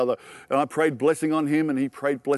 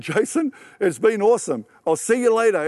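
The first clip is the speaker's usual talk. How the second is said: acted-out high, excited farewell; elated goodbye; 7 semitones higher, 4 dB louder than usual. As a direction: call it out high and upbeat, retelling. Breezy goodbye acted out to friend.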